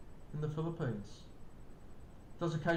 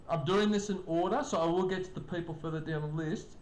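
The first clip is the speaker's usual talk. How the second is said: ordinary talk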